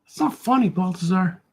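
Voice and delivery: high-pitched scolding character; heckling critic. Acted. Critic scolds the artist for joking, high heckling voice. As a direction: pitch it up and cut the joker down. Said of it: "hope that critic leaves me alone".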